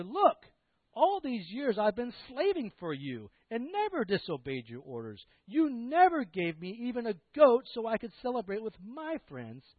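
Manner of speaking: aggrieved outburst, pitched high with hard emphasis; angry older brother. Acted. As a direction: protest loudly like a resentful son. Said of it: "The older brother came angry".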